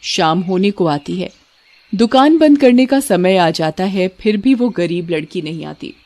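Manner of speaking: plain reading voice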